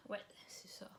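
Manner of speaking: a breathy whisper confirming a name; whispered to herself. Real, not acted. Whispering to herself after checking the author's name. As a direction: whisper it as a private note.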